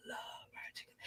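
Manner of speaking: whispered craving, breathy and hushed; dreamy whisper. Real, not acted. To herself, dreaming of fried chicken: a hushed whisper. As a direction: sigh it to yourself like you can taste it.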